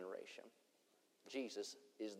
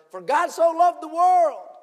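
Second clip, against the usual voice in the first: loud, high, slow proclaiming of scripture; booming voice heard; 23 dB louder, much slower than usual. Retold or imitated. Preacher voicing the verse the suicidal man heard, booming. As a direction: ring it out like a voice from heaven. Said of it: "he said".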